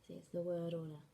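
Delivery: ordinary delivery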